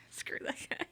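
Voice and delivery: whispered aside. Real, not acted. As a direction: hiss it under your breath. Aside about the slapper.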